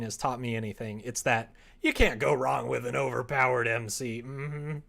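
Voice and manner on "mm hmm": ordinary talk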